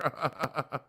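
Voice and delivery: real amused laughter. Real, not acted. Host tickled by the vanished video, chuckling. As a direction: keep chuckling after the words.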